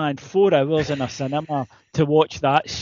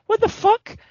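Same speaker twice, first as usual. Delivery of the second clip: incredulous. Real, not acted. Disbelief at Gandalf's new robe.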